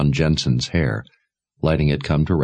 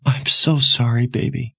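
higher, grieving mother's voice; tearful apology; 7 semitones higher than usual. A narrator's reading. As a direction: apologize through held-back tears, pitched up. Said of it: "gasping sob of hopelessness".

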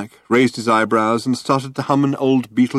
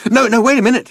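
high, loud, hurried protest; raised-voice plea to hold on; urgent interruption; 6 dB louder, faster than usual. A narrator's reading. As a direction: jump in before the chance slips away. Ford stalling as the moment slips.

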